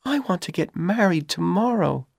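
Dorothy pleading. A narrator's reading. Dorothy pleads with young man.

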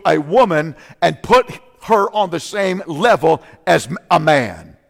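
full-force preaching about Jesus and women. Acted. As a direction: drive the point home to the congregation.

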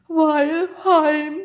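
high, loud wailing sob; sobbing. A narrator's reading. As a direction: wail it like a homesick creature. (laut und hoch geschluchzt)

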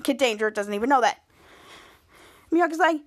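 usual speaking voice

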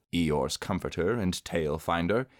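ordinary delivery

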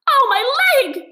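high, loud yelp of pain; pained cry. A narrator's reading. Mole crying out after tripping, high pained yelp. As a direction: cry out clutching a hurt leg. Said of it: "he cried".

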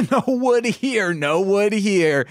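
high, drawn-out denial; mock denial. Acted dad denies having wood.

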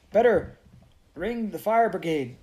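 high, loud and urgent; choked. Acted. Elizabeth urging a call for help, choked. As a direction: choke it out in alarm. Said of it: "choked Elizabeth".

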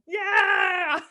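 high, loud cheer; excited shout. Retold or imitated. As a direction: yell it with joy. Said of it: "I was like".

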